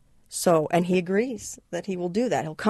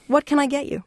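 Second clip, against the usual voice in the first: lightly voiced shop owner, higher; Angel's voice; 9 semitones higher than usual. Angel asking Richard for his order, lightly higher voice. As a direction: offer it cheerfully in the owner's voice. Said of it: "Angel asks again".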